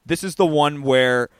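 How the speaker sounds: normal talking voice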